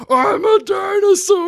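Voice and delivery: high, slow roaring shout; triumphant yell. Retold or imitated. Host acting out Luchasaurus's mask reveal, high slow triumphant yell. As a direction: bellow it like a reborn monster. Climax of the pitched storyline.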